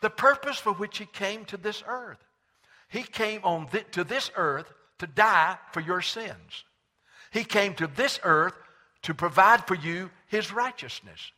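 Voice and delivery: loud, high-pitched pulpit preaching; full-voiced sermon delivery. Real, not acted.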